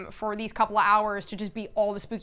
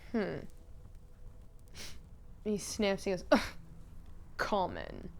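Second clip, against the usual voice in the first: low, soft contemptuous sniff; disdainful sneer; 4 semitones lower, 10 dB quieter than usual. Retold or imitated. Lord Radicek judging Odette's blood, low soft sneer. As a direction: look down your nose at her.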